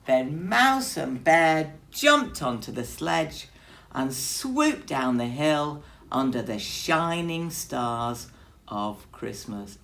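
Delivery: ordinary delivery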